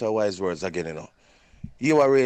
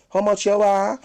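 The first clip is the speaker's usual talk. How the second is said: rude clerk; 12 semitones higher, 8 dB louder than usual. Retold or imitated. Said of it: "then they reply".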